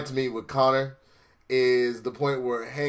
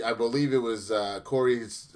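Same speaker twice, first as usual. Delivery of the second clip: normal talking voice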